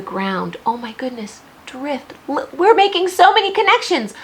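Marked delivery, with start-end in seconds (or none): 2.3-4.1 high, loud and fast with delight; bursting with excitement; excited. Real, not acted. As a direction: cheer it like a proud teacher. Teacher thrilled by connections.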